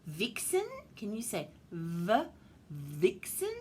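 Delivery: ordinary delivery, nothing marked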